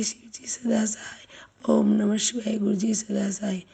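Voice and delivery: ordinary delivery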